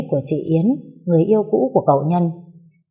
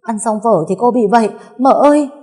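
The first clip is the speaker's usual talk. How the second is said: raised, urgent cry for help; higher, frightened, words tumbling out in bursts; panicked and trembling; 5 semitones higher, 4 dB louder than usual. A narrator's reading. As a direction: blurt bad news in rising alarm. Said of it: "giọng bàng hoàng run rẩy".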